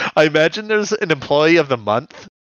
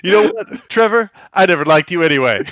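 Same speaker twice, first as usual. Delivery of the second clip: loud dismissive soldier; 5 semitones higher, 6 dB louder, faster than usual. Pretend. Soldier abandons comrade, joke.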